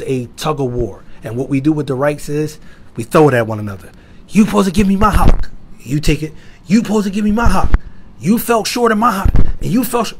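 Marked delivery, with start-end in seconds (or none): 4.3-5.5 mock demand, louder and higher; indignant quarrel voice, put on. Acted spousal quarrel over rights. 6.7-10.1 mock accusation, higher-pitched; indignant spouse, put on; accusing. Acted spousal quarrel over rights.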